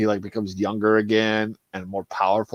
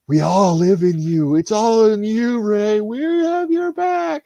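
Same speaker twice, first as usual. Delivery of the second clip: high, slow solemn chant; mock ghostly Jedi; 8 semitones higher, slower than usual. Dead Jedi voices reassuring Rey, mock solemn. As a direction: intone like ghostly voices from beyond.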